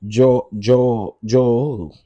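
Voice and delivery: loud, halting tease before a name; playful stutter trying not to say it; teasing stammer. Real, not acted.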